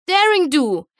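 high, rising battle cry; high, loud heroic charge cry; triumphant shout. A narrator's reading. Heroine launches her big entrance.

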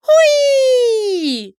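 high, loud joyful whoop; gleeful squeal. Acted. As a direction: squeal with delight. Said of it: "schrie sie vergnügt". (vergnügter, hoher Jauchzer)

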